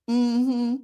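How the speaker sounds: ordinary talk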